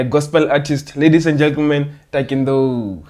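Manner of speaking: ordinary talk, nothing marked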